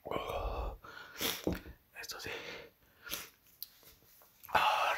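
usual speaking voice

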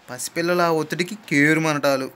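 plain reading voice

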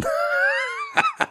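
a sharp high-pitched squeal of laughter; shrill cackling laugh. Real, not acted. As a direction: squeal with laughter at your own joke. High laugh after joke.

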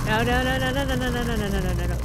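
loud, rapid pleading chant; frantic repeated no. Acted. Wrenching the door as the ghost nears, loud panic. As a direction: panic at the doorknob.

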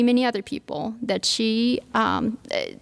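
normal talking voice

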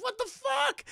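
very high-pitched incredulous cry; mock disbelief. Retelling McKay's reaction to the runaway, mock disbelief. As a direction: squeal it in mock outrage.

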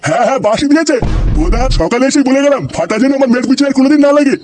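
high-pitched, indignant rant; outraged complaint; angry accusation. Acted. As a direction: rail at him, pitch held high. Angry complaint about grandson harassing daughter.

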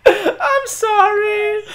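helpless, high laughter through the words; real giggling apology; laughing fit. Real, not acted. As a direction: apologise while cracking up.

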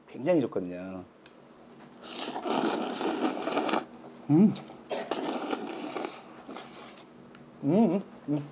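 chewing while humming; slurping noodles mid-bite; mouth full of noodles. Real, not acted. Seen 1: Slurps noodles into mouth, then chews with full cheeks while humming.

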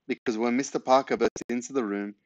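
plain reading voice

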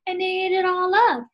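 high little bear voice, upset; tiny cub whining about his empty porridge bowl; Baby Bear. Acted. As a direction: squeak it out like the smallest bear cub. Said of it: "Baby Bear said".

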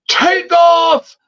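high, drawn-out mock yell; retold shouted order. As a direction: imitate yelling at the driver. Man orders driver to take off.

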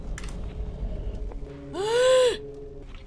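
high sharp gasp; frightened gasp; startled gasp. Real, not acted. Sudden scare.

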